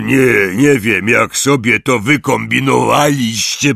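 loud, impatient Hagrid. Acted. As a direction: brush them off, fed up. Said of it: "powiedział niecierpliwie Hagrid".

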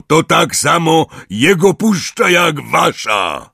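very loud, slow, high roar. Acted. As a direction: bellow it at them. Hagrid continues roaring.

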